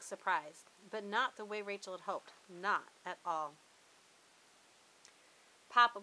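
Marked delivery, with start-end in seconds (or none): none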